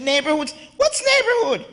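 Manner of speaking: high-pitched, baffled question; stunned. Retold or imitated. Newcomer stunned by talk of neighborhoods, acting baffled. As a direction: ask it in re-enacted disbelief. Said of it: "I was stunned when I got here".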